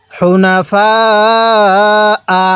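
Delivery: হুনাফা with the ফা held very long, then a short আ; four-alif elongation. Shown as a demonstration. Teacher shows the four-alif rule, drawn-out recitation. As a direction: stretch ফা level before closing on আ. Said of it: "চার আলিফ টান দিব".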